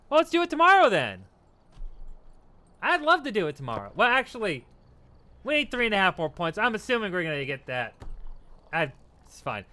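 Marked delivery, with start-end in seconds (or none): 0.1-3.9 excited. Real, not acted. Delighted at the offer.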